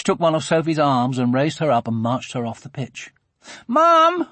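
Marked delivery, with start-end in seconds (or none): 3.7-4.3 put-on very high, loud child's call; urgent, high-pitched yell; shouting. A narrator's reading. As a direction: shout for a parent in alarm. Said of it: "they shouted".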